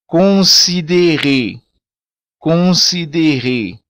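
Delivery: considérer slow, with a throaty r; considérer, slow, final syllable stressed; Creole word. Shown as a demonstration. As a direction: say considérer slowly with guttural r's. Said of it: "ainda mais acentuado no crioulo".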